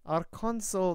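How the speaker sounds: put-on formal prelate in Russian accent; Russian-accented dignitary addressing guests. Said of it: "at the Russian accent".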